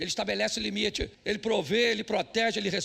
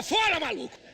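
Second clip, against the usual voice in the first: mock shout; 7 semitones higher, 3 dB louder than usual. Retells husband fleeing robbery.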